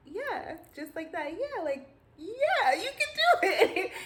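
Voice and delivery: very high, loud, gushing encouragement; hammed-up enthusiasm over a flat reply; overeager.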